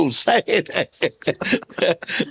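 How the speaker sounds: stretched way out, dissolving into laughter; mock lament drowned in giggles; laughing mock plea. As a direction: drag out say it ain't while cracking up.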